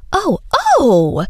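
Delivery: high delighted squeal. A narrator's reading. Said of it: "she squealed".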